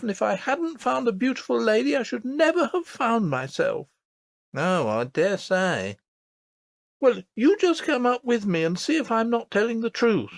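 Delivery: put-on high, earnest child's voice; a small girl insisting, wide-eyed; little princess voice. A narrator's reading. Princess tells her nurse about the lady, earnest.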